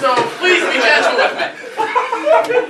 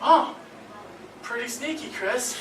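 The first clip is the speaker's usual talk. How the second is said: much softer, slow ad line; quiet ad quote; 8 dB quieter than usual. Pretend. Friend finishing the ad line, much softer. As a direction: let it land softly, eerie. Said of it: "he says".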